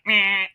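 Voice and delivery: short buzzing "meh" of a machine error; mean error beep. Retold or imitated. Customer imitating the register rejecting input, mean beep. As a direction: imitate a harsh register buzzer. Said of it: "one of those mean beeps".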